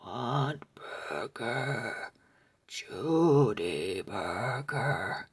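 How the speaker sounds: very low, slow, hungry zombie drone. A narrator's reading. Zombie doll moaning at Judy in the night, deep and slow. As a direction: drag each word out deep like a starving zombie.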